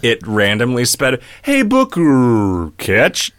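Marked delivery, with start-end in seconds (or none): 1.4-3.3 slow, halting, slurred drawl; drunk Jeff Goldblum. Shown as a demonstration. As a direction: stretch it out like a tipsy eccentric. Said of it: "kinda drunk Jeff Goldblumed it, where it randomly sped".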